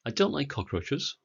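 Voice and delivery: usual speaking voice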